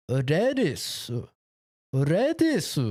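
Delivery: lettuce said "redisu" in a Japanese accent. Shown as a demonstration. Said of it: "Put a little accent in it".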